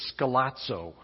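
"scalazzo" said slowly as skah-LAHT-so, stress on the middle; Greek word spoken. Shown as a demonstration. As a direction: carefully sound out "scalazzo" with a ts in the middle. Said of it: "it's a fun Greek word to say".

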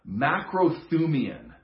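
"macrothumian" slow, each syllable clear: mak-ro-THOO-mee-an; Greek word demo. Shown as a demonstration. Preacher teaching the congregation a Greek word, slow and careful. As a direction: lay out "macrothumian" carefully for the class. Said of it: "And the Greek word there is the word".

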